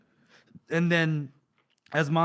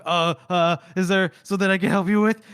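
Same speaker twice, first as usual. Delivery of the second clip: mock-flustered stammer, a bit raised and louder; 3 semitones higher, 5 dB louder than usual. Man caught staring at a woman's cleavage, flustered. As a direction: stumble over it, imitating a flustered man. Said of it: "he gets all flustered".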